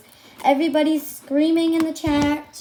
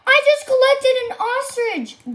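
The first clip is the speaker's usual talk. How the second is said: high, thrilled announcement; excited; 9 semitones higher, 3 dB louder than usual. Real, not acted. Streamer tells viewers about the new ostrich, excited.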